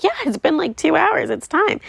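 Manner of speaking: high-pitched, imitated justification; retold defensive reply. New mother answering nurses about feeding, high defensive reply. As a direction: pitch jumps way up, mock-defensive.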